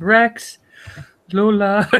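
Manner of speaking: high, loud blissed-out call; light airy voice. Acted. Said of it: "light, airy voice ... blissed out voice".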